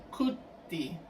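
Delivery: "cuti" with an u sound in the first syllable. Shown as a demonstration. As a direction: say "cuti" as a model form, u in place of o. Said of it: "se va a pronunciar como".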